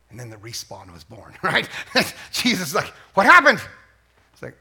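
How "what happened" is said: a sudden startled shout of a question; loud mock surprise. Preacher voicing Jesus waking from death, shouting in mock surprise. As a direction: blurt it out, amazed.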